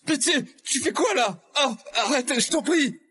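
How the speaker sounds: high-pitched, panicky begging; alarmed pleading. Acted. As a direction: beg him to stop in a high, urgent voice. Acted scene of begging Liam to stop.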